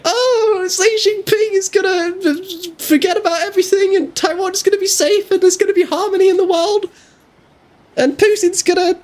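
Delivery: high, squeaky mock naive voice; mocking David's voice. Friend mimicking David in a silly high voice. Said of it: "don't do my voice like that".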